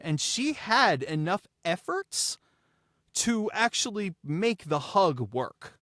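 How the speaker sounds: usual speaking voice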